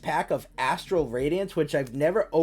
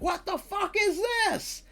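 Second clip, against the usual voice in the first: much higher, squeaky incredulous cry; mock disbelief. Retelling looking up V-Star cards, high incredulous cry. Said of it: "and I was like".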